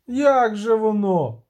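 a villager calls out in dismay; cried out. Acted. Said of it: "крикнув хтось із натовпу". (вигук із натовпу, повільно й голосніше)

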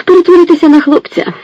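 very high, airy sailor voice; whistled out. A narrator's reading. Sailor tosses advice to the girl, whistling it out high. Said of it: "просвистів їй один моряк".

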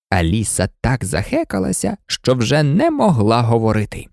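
ordinary delivery

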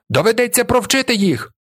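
high, squeaky threat; squeaky cry. A narrator's reading. Skinny boy waving his fist, squeaky shout. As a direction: pipe it up shrilly like a boy. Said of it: "кричить писклявим голосом".

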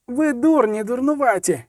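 high scolding shout between lashes; shouting while whipping. Acted. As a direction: yell at them as you whip them. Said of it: "кричала із лясканням". (високий лайливий крик під час шмагання)

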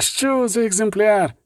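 rapture. Acted. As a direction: swoon over the specimen. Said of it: "у безмежному захваті".

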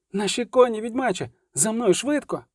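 high-pitched call, not full volume; urgent cry. A narrator's reading. Milva tells the witcher to follow, calling urgently. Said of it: "крикнула Мільва".

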